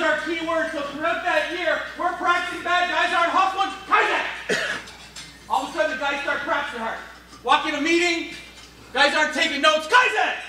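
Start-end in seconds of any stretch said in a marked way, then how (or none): 3.9-4.2 high, sharp catchword call; called-out reminder. Acted. Coach to players not hustling in practice, high call-out. 9.9-10.3 high, punchy one-word shout; shouted catchword. Acted. Coach to guys not taking notes, high shout. Coach correcting players in meeting.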